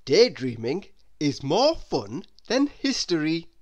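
plain reading voice

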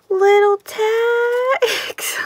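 high, slow, teary squeal; real delight, high and drawn out; excited squeal. Real, not acted. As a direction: melt over the tiny tags. Delight at small tags.

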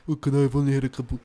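put-on rushed, slurred drunken accent. Player imitating a drunk in a cellar, fast slur. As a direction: run the words together like a drunk. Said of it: "That's how you act drunk".

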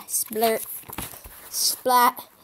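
ordinary delivery

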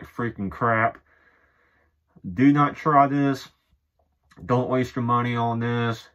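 speaking through fabric held over mouth; muffled through shirt. Real, not acted. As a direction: hand covering mouth. Seen 3: Hand presses shirt over mouth and nose throughout labelled words.

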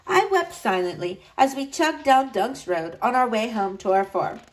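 ordinary delivery, nothing marked

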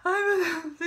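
imitated sobbing, high pitched; retold tearful admission. Girl admitting pregnancy to her mother, imitated crying. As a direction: burst out in mock crying. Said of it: "aquela choração".

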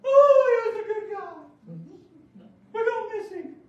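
loud, high mock lament; hammed-up wailing mourner. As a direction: overdo the grief like a hired mourner. Rich man's mourners wailing loudly.

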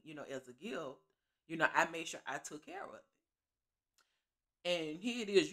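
normal talking voice

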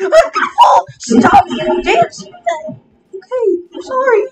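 high panicked yelling; screaming while falling. Acted. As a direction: shriek it, about to fall. Character about to fall, panics.

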